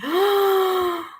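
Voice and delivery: long, high intake of breath; big astonished gasp; shocked gasp. Real, not acted. Reader hears he turned his back on her, shocked gasp. Reacts to viewer's comment.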